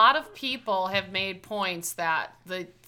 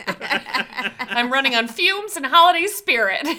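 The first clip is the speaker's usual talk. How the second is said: giddy frazzled baker; 5 semitones higher, 7 dB louder, slower than usual. Retold or imitated. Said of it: "She's like".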